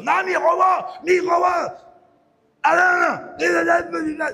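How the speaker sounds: loud, high, slow angry yell; the robbed man howling he was robbed; retold furious yell. Argentino gritando, roubado. (grito de raiva, alto e lento)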